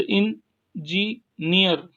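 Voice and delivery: Engineer slowly split into इन, जी, near; syllable-by-syllable demo. Shown as a demonstration. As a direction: pause between the parts of engineer. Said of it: "पे आप stress लगाएंगे".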